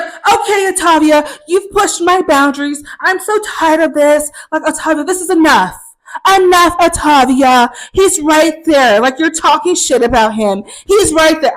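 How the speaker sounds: loud, high, exasperated roommate outburst; hammed-up outraged scolding; freaking out. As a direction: imitate a roommate blowing up, drawing out words. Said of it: "She freaks the fuck out".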